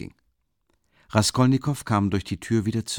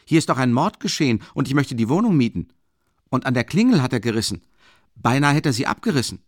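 raised, emphatic workman's voice; complaining workman; 5 semitones higher, 5 dB louder, faster than usual. Acted. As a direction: report the visitor's odd doings, higher and louder. Voices workman reporting to caretaker.

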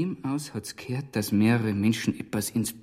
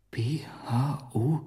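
slow whisper, spelling out letters; hushed discovery; whispered reading; 4 dB quieter, much slower than usual. Acted. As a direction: murmur the letters as you decipher them. Reading sign in hiding place.

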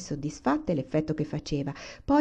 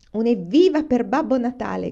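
raised, louder cheer; hearty shout; 5 semitones higher, 6 dB louder than usual. A narrator's reading. Papa cheers Santa at the table, raised shout. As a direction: call out a cheer for everyone. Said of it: "gridò papà".

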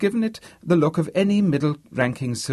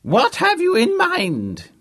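put-on very high, loud, slow old-man shout; old man's shout; 6 dB louder, slower than usual. A narrator's reading. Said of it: "he shouted at Harvey".

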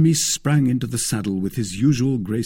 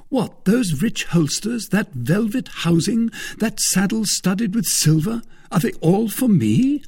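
astonished, delighted Aramis; 12 semitones higher, 4 dB louder than usual. Acted. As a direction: marvel at each item in a raised voice.

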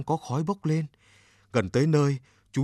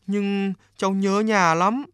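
put-on higher and slow, a homesick boy; boy blurting it out; 7 semitones higher, 3 dB louder, slower than usual. A narrator's reading. Boy confessing to the river god that he misses home, slow and high. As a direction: let the longing stretch out in a high young voice. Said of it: "Chú bé vội nói thật nhanh".

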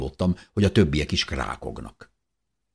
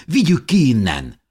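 loud, raised-pitch order; urgent command; 8 semitones higher, 8 dB louder than usual. A narrator's reading. Narrator wants the child carried out, urgently commanding. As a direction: urge them to move at once. Urging child's rescue from smoke.

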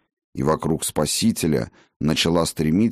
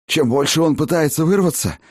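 high hoarse gasps on the run; hoarse while running; 10 semitones higher, 3 dB louder than usual. A narrator's reading. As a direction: rasp it out breathless mid-sprint. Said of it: "на бегу прохрипел лекарь".

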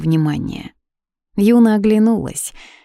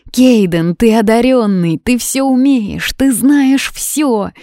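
high, loud, drawn-out insistence; desperate appeal; 6 semitones higher, 5 dB louder, slower than usual. A narrator's reading. Юна appeals to Кейден's gift, desperate and insistent. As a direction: plead with mounting urgency, pitch climbing.

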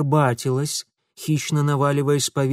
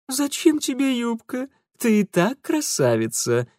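purring; 9 semitones higher than usual. A narrator's reading. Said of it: "промурлыкал Франт".

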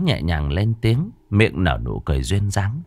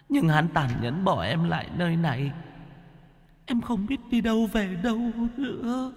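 put-on much higher and softer girl's voice; tearful ghostly girl; 8 semitones higher, 8 dB quieter than usual. A narrator's reading. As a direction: sad young woman, faint and pleading. Girl character voice.

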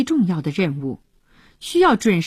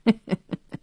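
cold laugh; 3 semitones higher than usual. Acted. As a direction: laugh coldly at him. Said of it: "冷冷地笑道".

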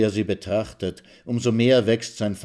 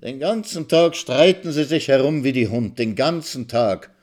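higher, spiteful, slowing at the end; venomous groan; 5 semitones higher, 4 dB louder than usual. Acted. Old woman griping at the quarrelling pair, venomous. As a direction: grumble poison from the window. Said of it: "ächzt die Alte giftig".

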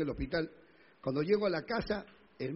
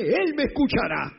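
loud, higher declaration of faith; proclaiming the psalm; 7 semitones higher, 8 dB louder than usual. Acted. Preacher reciting the psalm verse, loud proclamation. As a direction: declare it with full force. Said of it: "el Salmo setenta y siete".

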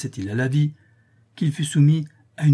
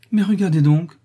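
higher, louder sudden cry; sudden exclamation; 7 semitones higher, 7 dB louder than usual. A narrator's reading. Madame Séraphin interrupting herself as the husband falters, sudden exclamation. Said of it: "s'écria tout à coup madame Séraphin".

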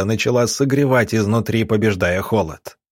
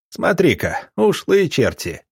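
higher-pitched scoff at the runaways; 7 semitones higher than usual. A narrator's reading. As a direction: say it as a huffed jeer, pitched up. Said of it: "хмыкнул Ахмед". (насмешливо хмыкая, повыше)

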